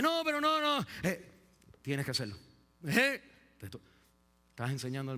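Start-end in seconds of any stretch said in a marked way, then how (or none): 0.0-0.9 put-on high, loud child's protest; a child stomping no; child refusing. Child protesting to his parent, high and loud. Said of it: "Y él dice".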